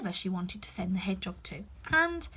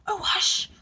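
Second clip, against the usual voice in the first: frightened hush; 11 semitones higher, 6 dB louder than usual. Acted. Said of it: "whispered in a frightened tone".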